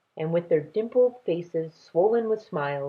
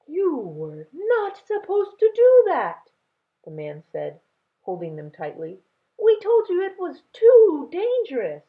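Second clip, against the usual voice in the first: lightly voiced scolding grandfather; 11 semitones higher, 6 dB louder than usual.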